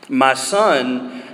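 ordinary delivery

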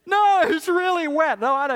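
very high, loud fake bawling; mock wail of a kid faking it; put-on crying. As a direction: insist through hammed-up child tears. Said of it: "seen your kids put on mourning".

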